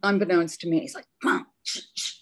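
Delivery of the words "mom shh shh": imitated urgent hushing; mock stage-whisper rising to a high squeak; shooing son. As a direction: hush and shoo like a kid caught in class. Son shooing mom out of class.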